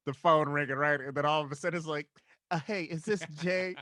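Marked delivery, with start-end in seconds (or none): 2.5-3.8 smooth flashy pimp swagger. Acted. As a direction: slick pimp on the phone. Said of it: "I love your, your fucking pimp voice".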